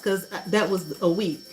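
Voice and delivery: usual speaking voice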